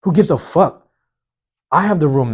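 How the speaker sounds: loud, high dismissive outburst. Real, not acted. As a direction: shout it off carelessly. Shrugging off others' mess.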